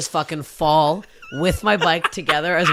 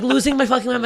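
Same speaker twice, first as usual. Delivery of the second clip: normal talking voice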